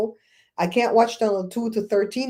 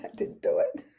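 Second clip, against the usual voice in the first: laughing denial; 8 dB quieter than usual. Pretend.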